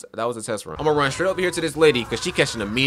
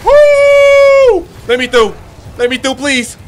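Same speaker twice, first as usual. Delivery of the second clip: high, loud pleading yell; trapped and yelling to get through; frantic yelling; 14 dB louder, much slower than usual. Real, not acted. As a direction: shove through shouting. Trapped, pushing through enemies.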